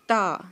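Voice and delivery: تا with tongue on the front teeth, said firmly; touch the tongue to the upper teeth and say تا; Arabic letter ta. Shown as a demonstration. Teacher drills تا with tongue on teeth. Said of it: "زبان touch کر کے".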